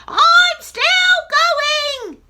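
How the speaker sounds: very high, loud crone screech; a screechy old woman crowing. Acted. Grandma screeching down from the attic. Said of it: "came the old screechy voice".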